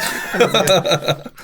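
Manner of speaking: loud, high burst of real laughter; big laugh. Real, not acted. Laughing hard at the memory of the hospital ward. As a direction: let a full laugh burst out. Laughs at his own retold line.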